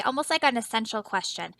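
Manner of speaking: ordinary talk, nothing marked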